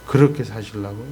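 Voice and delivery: usual speaking voice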